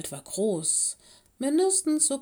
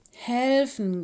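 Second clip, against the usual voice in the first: louder, higher owl protest; offended little bird, one raised word; indignant croak; 4 semitones higher, 5 dB louder than usual. A narrator's reading. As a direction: squawk it in mild outrage. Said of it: "krächzte Loris empört".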